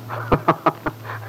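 a quick breathy laugh at her fear; short scoffing laugh. Acted. Husband laughing off his wife's fear, breathy scoff. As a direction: laugh it off dismissively.

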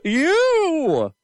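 high, loud trailer punch; dramatic shout. Pretend. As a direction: yell it as the big reveal.

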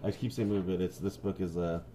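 usual speaking voice

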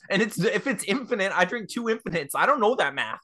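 high, loud, alarmed voice; acted panic. [name] tells friend he drank it, high acted panic.

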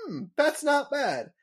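high, amazed first-taste wonder; like tasting pretzel for the first time; Marge tasting. Retold or imitated. Marge tries a pretzel, wondering. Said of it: "And she's like".

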